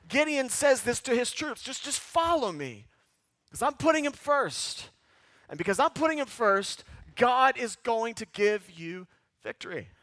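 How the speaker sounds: fiery sermon delivery; preaching at full force. Acted. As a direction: drive it home from the pulpit.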